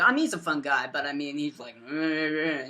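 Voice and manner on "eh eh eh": low, lightly imitated grumble; grumbling grandpa. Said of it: "my grandpa wasn't really happy".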